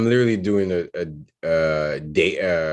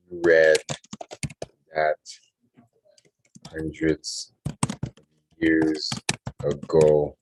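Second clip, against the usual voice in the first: word-by-word story composing, long pauses; slow dictation; much slower than usual. Real, not acted. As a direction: dictate it haltingly, as if writing along.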